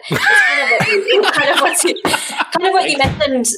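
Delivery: high voice breaking into laughter; laughing. Real, not acted. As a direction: struggle to get it out while laughing. Guest cracks up mid-sentence.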